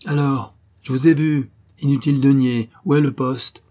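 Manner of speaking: bully the woman into confessing; angry interrogation. A narrator's reading. Nazi secoue Mathilde, interrogatoire brutal. (interrogatoire hurlé)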